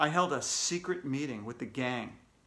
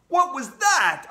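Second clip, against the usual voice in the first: put-on loud, raised boy's shout; 10 semitones higher, 8 dB louder, slower than usual. A narrator's reading. AJ seeing a vampire on air, shouting. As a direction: shout the question in alarm. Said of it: "I shouted".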